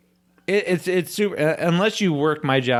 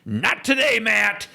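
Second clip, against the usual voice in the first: much higher, sharp mock woman's voice; mock snapping coworker; 11 semitones higher than usual. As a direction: shut him down without turning round. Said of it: "she goes".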